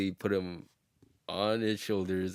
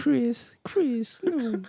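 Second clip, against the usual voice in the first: very high, breathy falsetto calls; much slower than usual. Retold or imitated. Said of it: "imitated [name] at the time".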